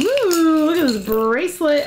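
high, admiring ooh; delighted. Real, not acted. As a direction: savour the find. Spotting bracelet.

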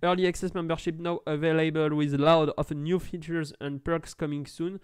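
reads the English site text in a put-on English accent, a touch slow at the end. Shown as a demonstration. YouTuber reading English website text to French viewers, in a hammed-up English accent. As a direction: show off a mock English accent. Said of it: "Vous voyez un magnifique accent anglais".

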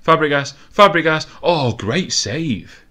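urgent live football commentary; building excitement. Real, not acted. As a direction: build to a shout, then a stretched reaction.

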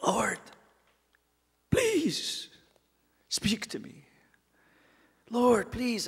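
soft, pleading prayer. Acted. A man praying for God to speak to him, softly pleading. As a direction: beg the Lord quietly. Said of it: "so this guy".

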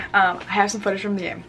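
usual speaking voice

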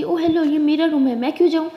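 angry retort. Acted. Sara shouting at Jess over her room. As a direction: snap back loudly in defiance.

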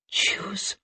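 a high, breathless whisper of dread; frightened whisper. Acted. Rika asked to choose an eye, whispering in fear. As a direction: barely dare to say it. Terrified girl faced with choice.

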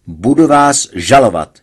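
loud cry of a threat; patient shouts a threat at the doctor; shouted. A narrator's reading. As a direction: yell it out at the doctor. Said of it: "vykřikl jsem".

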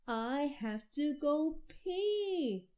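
high, loud, very slow wail; yelling child. A narrator's reading. Boy yelling from the snowy backyard, high slow loud wail. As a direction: drag out the yell like a desperate kid. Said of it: "and yelled".

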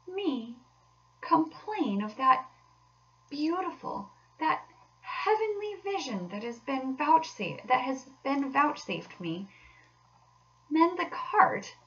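high, slow, wounded disbelief; rapturous Toad. Acted. Toad refusing to complain of his heavenly vision, enraptured. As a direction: swoon over each adjective, incredulous. Toad rhapsodises over the motor car.

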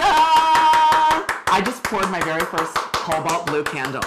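proud slow announcement. Pretend. Proud announcement of first candle.

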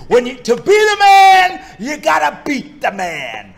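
loud, high shouted wrestling boast; Ric Flair. Acted. Performer restarting the requested impression, shouted wrestler catchphrase. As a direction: bellow it as a showboating champion. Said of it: "do a little Ric Flair".

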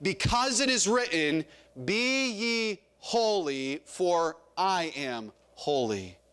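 slow, deliberate verse, falling at the end; measured scripture, word by word; leading group recitation. Shown as a demonstration. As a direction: space it out so the congregation can join. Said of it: "you can say it with me".

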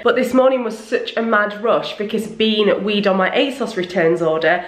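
ordinary talk, nothing marked

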